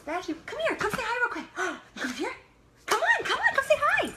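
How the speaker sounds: climbing into a loud squeaky call; sing-song puppy call, building up; high-pitched dog call. Real, not acted. Calling her dog over.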